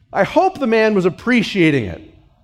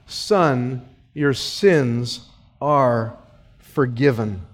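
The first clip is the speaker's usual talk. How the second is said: gentle Jesus; 7 semitones lower, 3 dB quieter, much slower than usual. Acted. Jesus forgives the paralysed man.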